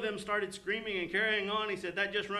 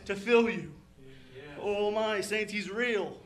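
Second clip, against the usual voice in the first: impassioned pulpit delivery with loud bursts; emphatic, heartfelt revival preaching; fervent preaching; 3 semitones higher, 5 dB louder than usual. Acted. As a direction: preach it with conviction to the saints. Preaching with rising fervour.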